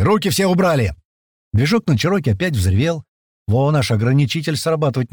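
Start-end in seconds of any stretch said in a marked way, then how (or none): 0.0-0.9 higher, urgent warning shout; yelled command. Acted. Senior man warns all before the shock, yelling. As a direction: warn everyone off at once. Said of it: "заорал старший".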